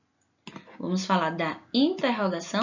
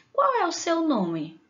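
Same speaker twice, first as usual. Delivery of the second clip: someone asking; 8 semitones higher, 3 dB louder than usual. Pretend. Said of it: "como se alguém perguntasse assim".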